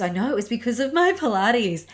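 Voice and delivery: happy retold exclaim. Client's happy reply, retold.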